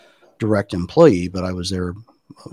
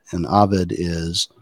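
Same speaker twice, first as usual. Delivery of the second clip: ordinary delivery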